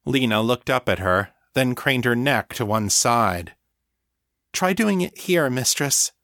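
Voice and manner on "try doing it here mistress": high, lightly voiced thrall; helpful thrall. Thrall showing her mistress where to bite, helpful. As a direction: guide her helpfully, pitched up.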